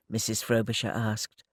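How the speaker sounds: usual speaking voice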